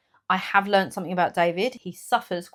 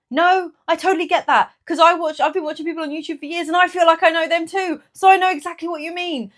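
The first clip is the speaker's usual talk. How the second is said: much higher, eager reassuring voice; eager reassurance; 10 semitones higher, 6 dB louder than usual. Retold or imitated. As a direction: reassure her warmly, pitched up high. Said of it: "And I was like".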